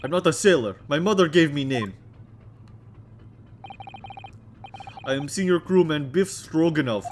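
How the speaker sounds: put-on loud, heavy Russian accent; Russian crewman. Crewman introducing himself to visitors, loud Russian accent. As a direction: boom it out like a proud Russian sailor.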